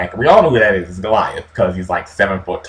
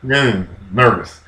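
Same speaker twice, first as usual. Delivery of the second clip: normal talking voice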